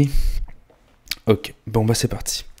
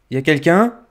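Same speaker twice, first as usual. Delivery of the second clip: calling out; 7 semitones higher, 5 dB louder than usual. Acted. Calling out into empty house.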